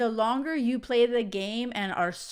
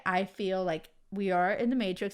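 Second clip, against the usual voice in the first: ordinary talk, nothing marked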